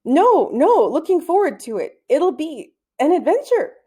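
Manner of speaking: pitched high, forcing a bright cheer; nervous boy faking high enthusiasm; forced cheerfulness. Acted. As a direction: sell it like you can't wait, hiding the nerves. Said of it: "gave a good impression of his smile".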